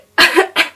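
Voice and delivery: loud harsh cough; sick cough. Real, not acted. Sick vlogger coughing loudly mid-story. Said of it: "Sorry, I'm very sick".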